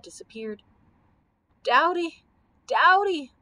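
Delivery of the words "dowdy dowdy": high, loud plaintive call; wailing. A narrator's reading. Camel turns to his humpbacked friend, wailing. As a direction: wail the name out. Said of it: "wailed the Comfortable Camel".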